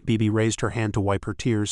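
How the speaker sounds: ordinary delivery, nothing marked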